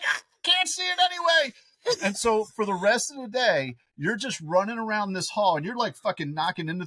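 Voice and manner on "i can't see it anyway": put-on much higher, giggly toddler voice, loud; imitating a little kid. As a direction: play the delighted three-year-old. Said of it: "You were like".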